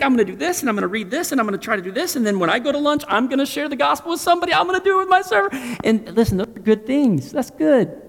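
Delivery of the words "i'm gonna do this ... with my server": higher, brisk mock-eager plans; mock eager planner. Preacher imitating a listener's eager to-do list. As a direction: list the plans like a keen believer.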